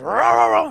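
sudden loud snarling burst; imitated dog snarl. Owner imitating his dog lunging at a guest, loud snarl.

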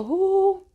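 high, gentle, drawn-out "uh"; soft "uh". Shown as a demonstration. Teacher showing how to minister to someone gently, soft "uh". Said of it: "se le entre así suavecito".

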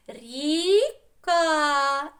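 "Ріка" in two long, loud, high syllables. Shown as a demonstration. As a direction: stretch both syllables of "Ріка", splitting them apart. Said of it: "спробуємо збудувати звуковий будиночок".

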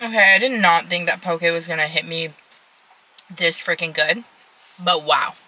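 talking between bites; chewing while talking. Real, not acted. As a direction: mouth partly full. Seen 0: eating poke bowl in car, jaw working, bites throughout.

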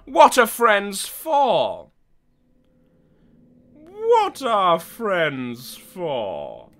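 slow, drawn-out yokel voice; a lightly hammed hayseed reading; talking like a rube. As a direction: drag the words like a dim country rube. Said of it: "talking like a fucking rube".

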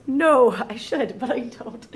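real laugh at herself; laughing admission. Real, not acted. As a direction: own up with a sheepish laugh. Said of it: "do I have this whole list memorized".

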